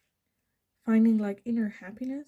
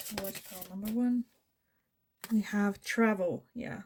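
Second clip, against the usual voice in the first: ordinary delivery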